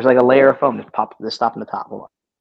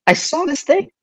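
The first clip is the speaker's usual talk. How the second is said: much higher, incredulous and baffled; disbelief; 9 semitones higher than usual. Retold or imitated. Retelling the vanished soda display, pitched-up disbelief. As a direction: squeak it out like you can't believe it's gone. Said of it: "And I'm like".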